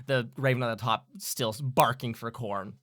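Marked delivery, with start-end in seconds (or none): none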